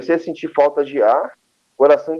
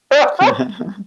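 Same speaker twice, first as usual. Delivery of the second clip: amused laugh; 10 semitones higher than usual. Real, not acted. Laughs at doctor's reply.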